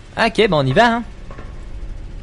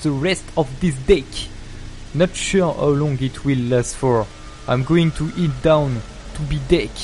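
slow reading in a French accent; French-accented English; slower than usual. Real, not acted. Streamer reading a game note aloud, French-accented English. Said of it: "Quel bel accent anglais".

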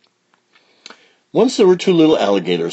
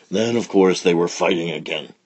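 ordinary delivery

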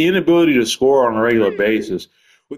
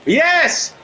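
excited cheer. Real, not acted. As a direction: cheer the hit. Celebrates a big hit.

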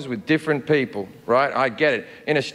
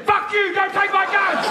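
angry gun owner's yell. Retold or imitated. Comedian mocking Americans who refuse a gun ban, in a high furious yell. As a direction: scream it like an outraged gun nut. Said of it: "fifty percent of you went".

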